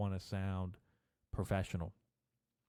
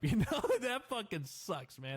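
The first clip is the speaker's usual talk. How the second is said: laughing; 10 semitones higher than usual. Real, not acted. Laughing at his own fear.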